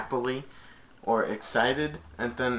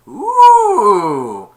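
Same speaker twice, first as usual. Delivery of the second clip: high, loud squeaky ooh; a little monkey amazed by treasure; loud high awestruck ooh; monkey ooh of wonder; 9 semitones higher, 15 dB louder than usual. Retold or imitated. Abu sees the treasure.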